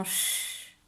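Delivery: "ch-" as a long voiceless shhh; isolated sound. Shown as a demonstration. Teacher isolating the sound heard in château, drawn-out shhh. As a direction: hold just the hushing consonant of "ch-". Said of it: "j'entends le chat qui chante".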